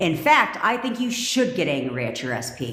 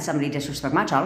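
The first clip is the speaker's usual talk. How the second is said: ordinary talk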